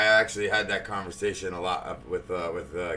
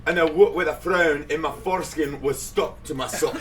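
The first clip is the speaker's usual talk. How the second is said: put-on Scottish accent; 7 semitones higher, 5 dB louder than usual. Performing a dirty limerick for the chat room, Scottish accent. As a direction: recite it grandly in a mock Scottish accent. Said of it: "can you do a, uh, Scottish accent".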